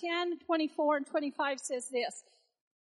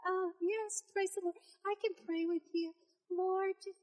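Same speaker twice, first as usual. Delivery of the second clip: sudden soft polite phone voice; sweet phone voice; 3 semitones higher, 7 dB quieter than usual. Retold or imitated. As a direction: switch to churchy sweetness. Mom answers phone sweetly.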